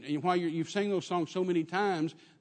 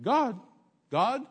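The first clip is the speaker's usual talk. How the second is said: puzzled lady; 7 semitones higher, 4 dB louder than usual. Retold or imitated. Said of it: "And she said".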